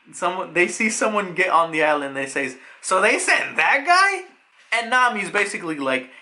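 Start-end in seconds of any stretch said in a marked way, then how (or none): 2.8-4.2 high-pitched, drawn-out incredulous question; slow rising disbelief, acted out; mock disbelief. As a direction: imitate stunned disbelief, pitching it up. Characters react to the admiral's arrival.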